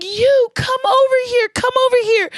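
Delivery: high, loud, teary calling; excited calling. Retold or imitated. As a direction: call her over, choked up and loud. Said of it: "she's like".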